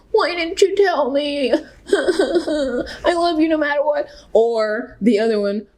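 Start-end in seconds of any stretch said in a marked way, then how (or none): 0.1-4.1 high, slow, sobbing and laughing through the words; mock tearful crying. Family member crying over a coming out, mock sobbing. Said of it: "they're all crying".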